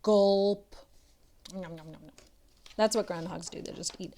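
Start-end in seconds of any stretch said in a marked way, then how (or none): 0.0-2.1 a light character gulp; groundhog gulp. Acted. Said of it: "And he's saying".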